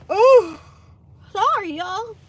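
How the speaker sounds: talking through a yawn. Real, not acted. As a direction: yawn mid-word. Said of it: "don't wanna see me yawning".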